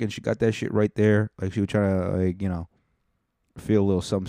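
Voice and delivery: usual speaking voice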